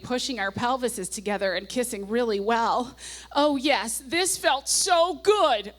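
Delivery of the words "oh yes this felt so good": loud, high, and slow, savoring it; all caps. Retold or imitated. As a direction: belt the words out as diary capitals. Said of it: "all caps".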